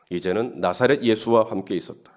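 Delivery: usual speaking voice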